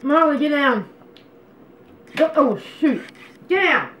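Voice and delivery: loud, high call to get the dog down; calling out to pet. Real, not acted. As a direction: yell up at her to get down now.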